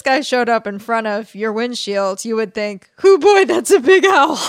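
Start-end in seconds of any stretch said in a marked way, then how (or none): 3.0-4.4 high loud startled yelp. Pretend. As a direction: jolt out a shocked cry. Imagined driver sees huge owl.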